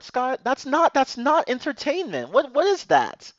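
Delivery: high, loud indignant outburst; exasperated rant. Real, not acted. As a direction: rant in disbelief. Ranting about the Frozen short.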